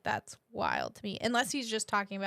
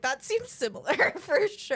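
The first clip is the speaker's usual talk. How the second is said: laughing; 5 semitones higher, 4 dB louder than usual. Retold or imitated. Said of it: "I'm like".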